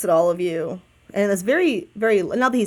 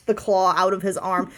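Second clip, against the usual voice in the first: ordinary talk, nothing marked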